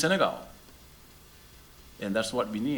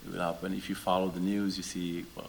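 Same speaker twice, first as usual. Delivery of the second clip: normal talking voice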